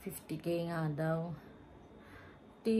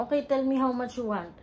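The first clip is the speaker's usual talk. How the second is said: pushy vendor; 5 semitones higher, 5 dB louder than usual. Retold or imitated. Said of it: "sabi niya".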